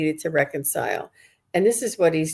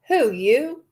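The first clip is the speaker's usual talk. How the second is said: higher, breezy, dismissive with a laugh; nonchalant brush-off; 7 semitones higher than usual. Retold or imitated. Dad brushing off his daughter, nonchalant and laughing. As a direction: toss it off like it can wait. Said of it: "he's still a little nonchalant".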